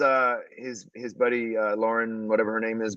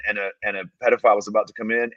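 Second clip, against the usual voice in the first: ordinary talk, nothing marked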